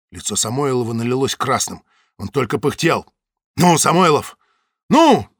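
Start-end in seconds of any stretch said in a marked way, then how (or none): 3.6-5.3 loud, rising high urging; urging shout. A narrator's reading. Zaytsev presses Samoylov, loud urging shout. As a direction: press him hard to answer.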